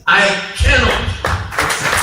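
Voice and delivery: loud, high declaration; emphatic refusal. Real, not acted. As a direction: refuse it forcefully to the whole hall. Emphatic refusal in a public speech.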